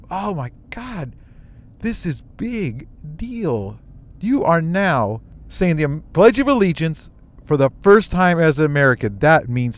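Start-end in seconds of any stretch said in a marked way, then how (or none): 0.1-3.8 awed thought. Retold or imitated. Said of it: "I just like".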